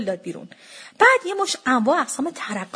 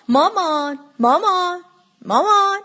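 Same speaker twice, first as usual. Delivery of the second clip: repeated calls climbing higher and louder; calling out; 7 semitones higher, 5 dB louder, slower than usual. A narrator's reading. Trying to reach mother behind the counter, insistent calling. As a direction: call for Mum, more insistent each time. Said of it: "داد زدم".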